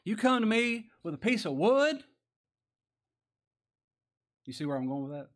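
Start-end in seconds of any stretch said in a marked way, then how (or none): 0.0-2.0 high, loud incredulous question; acted scorn at a puny weapon; appalled Goliath. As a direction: rise sharply in offended disbelief. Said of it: "just appalled at this".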